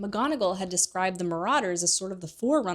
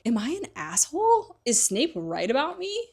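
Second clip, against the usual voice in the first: anxious question; 7 semitones higher than usual. Retold or imitated. Harry's horrified inner question.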